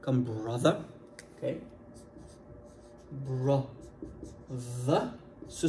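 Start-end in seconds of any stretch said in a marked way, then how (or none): 0.2-0.8 "brother" avec un TH voisé; TH vocalique. Shown as a demonstration. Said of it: "son T H qui est vocalique". 3.3-5.1 "Brother" very slowly, voiced TH drawn out; TH vocalique. Shown as a demonstration. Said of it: "vocalique, c'est-à-dire qu'on le parle".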